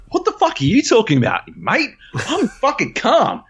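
high-pitched, indignant re-enacted shout; outraged yell. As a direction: protest at a yell, anything but calm. Acted outraged comeback claiming to be calm.

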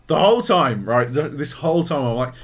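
ordinary talk, nothing marked

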